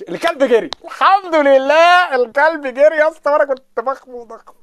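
high, loud cry of relief, drawn out; mock triumphant shout. The dog he feared runs off, he shouts high and loud in relief. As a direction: crow that the dog ran away.

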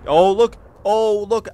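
loud, high excited cry; excited shout. Real, not acted. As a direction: yell it out in delight. Excited about passing jump.

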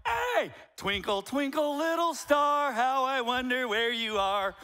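high, drawn-out exuberant shout; loud hollering. Acted. Preacher showing how he comes up to preach, loud holler. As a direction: belt out the rhyme with big energy.

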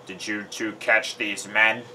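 slow, measured general's question; lightly voiced military general. A narrator's reading. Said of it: "asked the general".

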